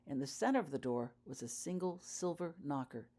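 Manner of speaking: plain reading voice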